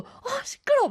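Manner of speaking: sharp high exasperated cry; irritated complaint at noisy neighbours; annoyed outburst. Retold or imitated. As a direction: blurt out irritation at the noise. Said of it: "막 계속 이러고 있는 거예요".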